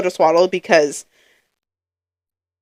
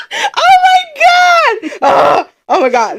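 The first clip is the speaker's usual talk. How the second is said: high, loud, drawn-out cry, then easing down; tearful outburst; 5 dB louder, much slower than usual. Real, not acted. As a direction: wail it out as real tears hit. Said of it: "I'm about to start crying".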